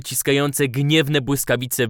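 ordinary delivery

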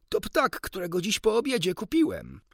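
high, loud burst of realisation; excited exclamation. A narrator's reading. Doctor realising it is his new bird, excited exclamation. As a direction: call it out, pitch leaping up. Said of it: "zawołał doktor".

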